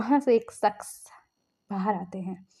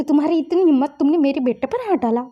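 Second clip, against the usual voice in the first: angry uncle; 4 semitones higher, 6 dB louder, faster than usual. A narrator's reading. Angry uncle confronts attacker.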